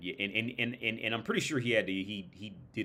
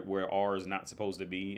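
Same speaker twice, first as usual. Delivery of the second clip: ordinary talk, nothing marked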